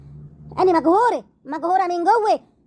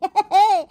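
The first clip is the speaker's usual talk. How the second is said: mocking laugh; 5 semitones higher, 4 dB louder than usual. Wife laughs at his logic.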